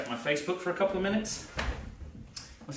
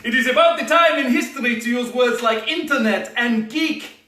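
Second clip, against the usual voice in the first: higher, louder bad Russian accent; terrible Russian accent; 6 semitones higher, 7 dB louder than usual. Alan Cumming's hacker showing off, bad Russian accent. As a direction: ham up a fake Russian accent. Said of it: "to do a terrible accent".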